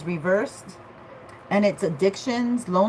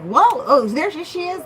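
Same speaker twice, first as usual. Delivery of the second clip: excited; 9 semitones higher, 5 dB louder than usual. Retold or imitated. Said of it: "they're like".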